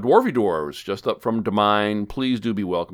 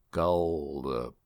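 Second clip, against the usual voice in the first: Gullible said slowly and stretched out; a teasing slow demo of Gullible; 7 semitones lower, 6 dB quieter, slower than usual. Shown as a demonstration. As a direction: draw out Gullible slowly so it sounds like orange. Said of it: "if you say the word gullible slow enough".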